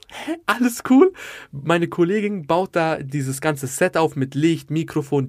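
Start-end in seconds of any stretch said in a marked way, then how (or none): 0.1-1.1 very high, rising incredulous question; baffled self-mockery. Comedian questions his own awkward reply to the rapper, incredulous. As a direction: squeak it back as a puzzled question.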